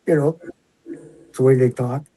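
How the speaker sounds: normal talking voice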